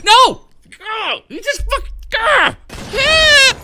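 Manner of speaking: high frantic shrieks; panicked screaming. Real, not acted. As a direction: scream as everything goes wrong.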